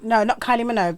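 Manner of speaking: normal talking voice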